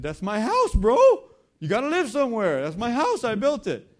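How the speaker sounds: loud, high-pitched castaway protest; emphatic shouting. Retold or imitated. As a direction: proudly shout it at the doubting visitor. Castaway defends his house, shouting.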